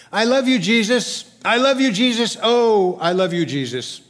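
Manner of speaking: "I love you, Jesus" high and loud, easing on the last; emphatic repetition. Retold or imitated. Preacher reads a child's psalm, "I love you, Jesus" in emphatic repetition. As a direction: repeat "I love you, Jesus" insistently, then settle on the final one. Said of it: "thrice repetition for emphasis".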